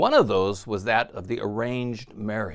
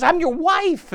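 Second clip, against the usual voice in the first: exasperated cry; 7 dB louder than usual. Retold or imitated. Wife's exasperated answer.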